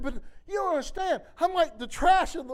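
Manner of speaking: high, loud pleading objection; distressed protest. Retold or imitated. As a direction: protest your own worthlessness, acting it out. Acts out protesting to God.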